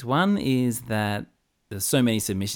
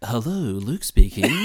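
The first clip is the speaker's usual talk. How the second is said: slow, louder, deepened formal phone voice; put-on hosting voice; 6 dB louder, slower than usual. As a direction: answer the phone like a newsreader. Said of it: "a Sandra Sully voice".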